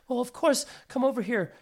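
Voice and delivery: a mother eagerly waving him in; welcoming mother. Acted.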